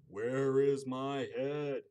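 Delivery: slow booming ghoulish voice; skeleton voice. Acted. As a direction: drag it out, loud and hollow. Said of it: "the skeleton said".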